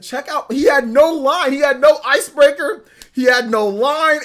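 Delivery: high, loud, disbelieving; real incredulous outburst; incredulous rant. Real, not acted. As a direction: rant in disbelief about a friend. Incredulous retelling of friend.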